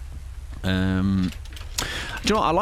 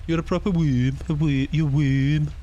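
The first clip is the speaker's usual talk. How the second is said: a Brummie accent pitched a bit higher; 4 semitones higher than usual. Shown as a demonstration. Streamer trying out a Brummie accent on the line. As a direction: lay on the Birmingham vowels in proper and worm. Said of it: "what if you said it in a Brummie accent?".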